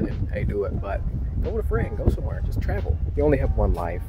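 usual speaking voice

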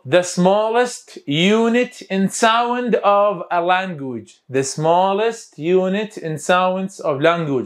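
very slow, drawn-out word by word; slow dictation. Shown as a demonstration. Teacher gives students a definition to memorise, slow dictation. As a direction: dictate it slowly for learners.

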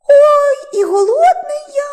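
very high, squeaky wail; hammed-up high hungry whine; whining mouse. A narrator's reading. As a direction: wail for food as a tiny mouse.

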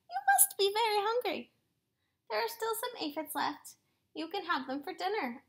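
friendly ladybug voice. Acted.

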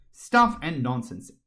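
slow, loud dismissal from Alice. A narrator's reading. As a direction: say it loudly, stretching the words. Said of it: "said Alice loudly".

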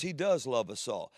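normal talking voice

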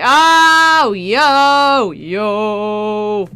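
thrilled yelling; excited. Real, not acted. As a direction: react as if the card stunned you. Sees a great card.

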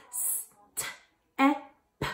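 "S-T-E-P" as separate short sounds; "S-T-E-P" sound by sound, softly, gaps between; word chopped into sounds. Shown as a demonstration. Teacher segmenting step for kids, "S-T-E-P" chopped up. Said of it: "I'm going to chop up a word".

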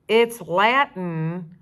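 slow. Acted. Frank explains slowly.

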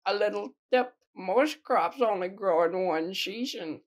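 Granny's voice. Acted. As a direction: read Granny's tip in a high voice. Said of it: "I don't care, Granny".

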